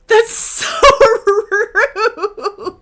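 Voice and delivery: high, loud laughing protest; laughing outrage. Real, not acted. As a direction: laugh through mock offence.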